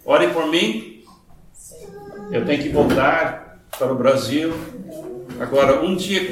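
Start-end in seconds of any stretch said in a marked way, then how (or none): none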